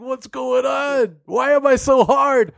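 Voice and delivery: high-pitched, drawn-out mock demand; high, over-the-top character wail; hammed-up crazy guy. Voicing the imagined knife-wielding Australian guy.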